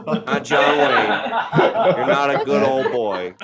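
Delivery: slow high teasing; knowing mock disbelief; calling his bluff playfully. Real, not acted. Mocking the singer.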